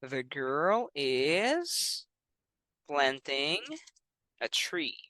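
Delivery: drawn out word by word; slow model sentence. Shown as a demonstration. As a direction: model it slowly for the student. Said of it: "So maybe you can say".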